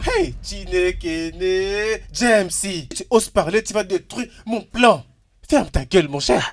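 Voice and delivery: loud, high, slow-drawn outburst; angry silencing. Acted. Boss hushing servant who may ruin his plan, angry. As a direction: shout him down in alarm.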